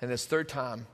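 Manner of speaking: ordinary delivery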